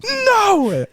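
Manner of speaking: high, stretched, melodramatic cries; hammed-up stage yelling; imitated scream. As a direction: overact each line as a wail. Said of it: "what was up with the scream".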